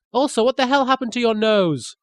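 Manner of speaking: usual speaking voice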